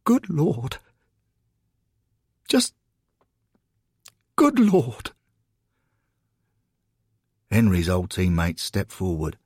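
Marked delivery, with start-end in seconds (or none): 0.0-5.1 high-pitched, stunned exclamation; overwhelmed amazement in pieces; astonished. Acted. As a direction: gasp it out in disbelief, pitched high. Henry stunned by welcoming crowd.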